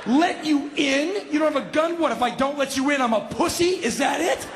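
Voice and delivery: high-pitched, outraged disbelief; incredulous challenge. Retold or imitated. Homeowner challenging burglar's demands, incredulous. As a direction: throw each demand back as a high question.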